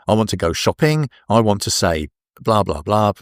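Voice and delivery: ordinary talk, nothing marked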